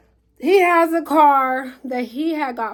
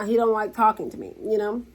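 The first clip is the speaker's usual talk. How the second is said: usual speaking voice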